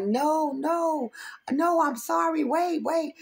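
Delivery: high, hurried begging; pleading apology. Retold or imitated. Imitating the driver begging her, high pleading. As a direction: mimic him begging her to stop.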